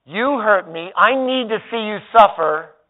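hurt and aching for payback; acted wounded grudge; passive anger voice. Shown as a demonstration. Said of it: "Passive anger looks and sounds like this".

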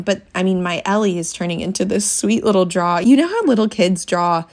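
ordinary talk, nothing marked

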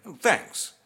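short, clipped thanks; a touch of curt dismissal. A narrator's reading. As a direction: brush him off with two words. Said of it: "he said curtly".

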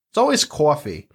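coffee with a Long Island vowel; Long Island accent. Retold or imitated. Recalling the one word her accent showed on, Long Island accent. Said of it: "Long Island accent".

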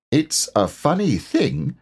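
high, slow storybook animal voice; Rabbit. A narrator's reading.

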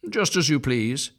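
put-on a touch of offended old duck; huffy old duck. A narrator's reading. Old duck leaving in a huff, mildly huffy. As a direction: shrug it off in a huff. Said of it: "said the old duck".

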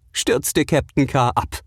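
plain reading voice

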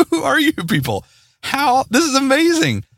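high, excited squeal of disbelief with a laugh; amazed disbelief. Retold or imitated. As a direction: play the stunned delight of finding out listeners reached out. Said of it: "I'm like".

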